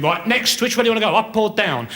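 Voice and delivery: a busy doctor working through his queue; brisk doctor. Retold or imitated. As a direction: rattle off like a doctor on a conveyor belt. Doctor dispensing drugs, voiced.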